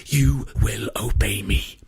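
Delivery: slow rasping whisper; harsh whisper. A narrator's reading. Ishmael bends Rintel to his will, in a slow harsh whisper. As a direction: hiss the command slowly, almost hypnotic. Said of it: "his voice slowing to a harsh whisper".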